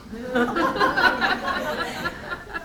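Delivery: high airy burst of laughter; laughing at the memory; real laugh. Real, not acted. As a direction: break into laughter at own story. Laughing at own story.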